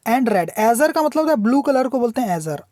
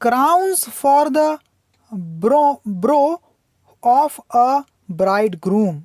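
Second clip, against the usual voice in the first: poem reading; much slower than usual. Shown as a demonstration. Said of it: "teacher reads poem line slowly".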